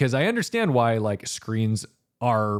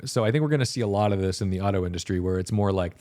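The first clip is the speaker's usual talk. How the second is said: normal talking voice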